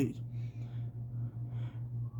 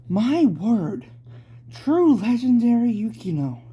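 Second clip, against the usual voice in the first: awed exclamation; 8 semitones higher, 4 dB louder than usual. Acted.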